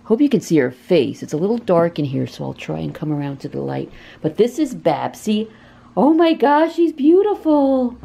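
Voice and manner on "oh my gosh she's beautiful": high, delighted gush; gushing over the cat. Real, not acted. To viewers about the cat Babsy, a high delighted gush. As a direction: melt over how pretty she is.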